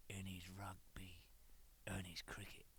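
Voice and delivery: whispered. Acted. Whispering what the homesick manager misses. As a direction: whisper the last items, wistful. Wistful list of missed things.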